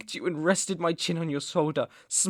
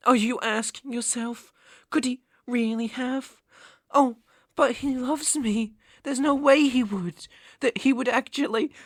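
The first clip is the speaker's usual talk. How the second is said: higher-pitched taunting; mocking mimicry; 7 semitones higher than usual. Captor voices captive's doubts about his love, mocking. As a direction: mimic the victim's disbelief in a high voice. Mocks the captive's frightened thoughts.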